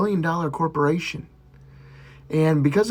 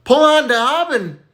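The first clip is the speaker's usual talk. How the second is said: much higher, slow hammed-up reply; [name]'s punchline; 12 semitones higher, 4 dB louder, slower than usual. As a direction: stretch out the punchline high and slow.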